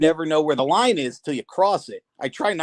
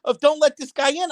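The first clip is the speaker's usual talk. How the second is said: retold shout; 9 semitones higher than usual. Neighbour yelling about the posters.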